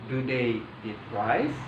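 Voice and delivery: slow question rising on RICE; intonasi bertanya. Shown as a demonstration. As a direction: model it slowly, voice up on "rice?". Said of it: "intonasinya seperti intonasi bertanya". (nada bertanya, pelan, "rice?" naik)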